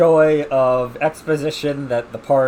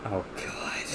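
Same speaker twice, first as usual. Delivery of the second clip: breathy whispered oh God; 7 semitones lower, 17 dB quieter than usual. Real, not acted. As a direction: whisper it in dismay. Reacting to the joke.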